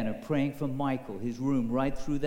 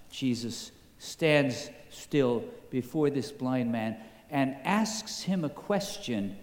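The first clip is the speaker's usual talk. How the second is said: ordinary talk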